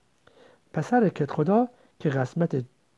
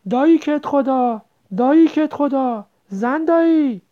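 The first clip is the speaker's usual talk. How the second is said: high, loud, drawn-out hail; calling out; 10 semitones higher, 7 dB louder, slower than usual. A narrator's reading. Boy calling the headman's household over barking dogs, calling out. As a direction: call across the yard for help. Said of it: "بگذار یکی را صدا بزنیم".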